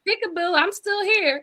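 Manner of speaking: put-on high, sing-song baby tease; playful peekaboo. Host voicing the look-alike baby teasing its mother, high and playful.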